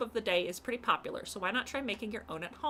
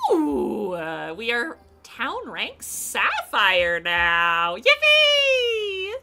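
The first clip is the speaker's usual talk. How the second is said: loud, slow, joyful announcement; excited cheer; 2 semitones higher, 14 dB louder, much slower than usual. Real, not acted. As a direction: shout it out in triumph. Celebrating rank up loudly.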